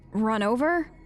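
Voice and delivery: ordinary talk, nothing marked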